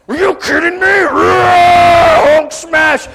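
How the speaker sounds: loud, high mock-rage shouting; enraged outburst. Husband retorting to his wife, hammed-up angry roar. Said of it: "You know when people get that angry".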